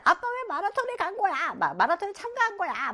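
high mock-angry child; angry family. Teacher acting the family scolding dad, high mock anger. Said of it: "막 이렇게 화를 냈다".

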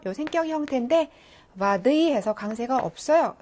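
ordinary talk, nothing marked

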